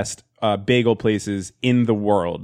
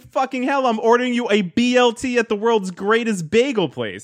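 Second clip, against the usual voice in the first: indignant rant; 11 semitones higher than usual. Retold or imitated. Ranting about BLT order.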